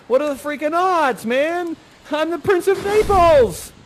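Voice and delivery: a hyped-up prince shouting his odds; excited. Acted. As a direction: crow about being the prince.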